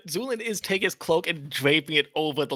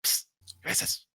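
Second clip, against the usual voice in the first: whispering; 6 dB quieter than usual. Acted. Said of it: "the only one who was confident is like".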